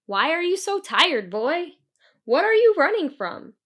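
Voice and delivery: put-on a big creature calling down to a tired boy; centaur's voice.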